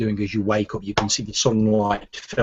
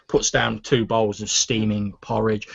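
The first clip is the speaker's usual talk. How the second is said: ordinary talk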